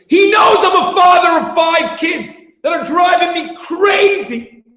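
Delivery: a son fuming that he's overloaded and insulted; exasperated rant. Retold or imitated. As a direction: rant like a father at his wits' end. Teacher acts out exasperated son.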